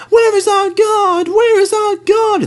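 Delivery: very high, slow anxious wail; crying out. Acted. Coaches cry out for their guard, very high. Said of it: "they cried".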